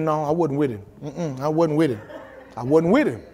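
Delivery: quiet denial with a chuckle; Peter's denial with a laugh; mock denial. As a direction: softly brush it off, laughing. Peter's denial, voiced in the story.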